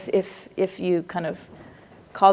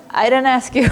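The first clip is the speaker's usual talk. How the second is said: higher, loud comic protest through laughter; laughing retort; 5 semitones higher, 6 dB louder than usual. Acted. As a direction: protest playfully, breaking into a laugh. Mock retort to unwanted advice, laughing.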